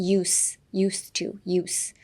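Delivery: "ユース" with a clean unvoiced s, softly; S sound. Shown as a demonstration. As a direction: softly show "ユーストゥ" with s, never z. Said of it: "エスになります". (「ユース」「ユーストゥ」をエスの音で)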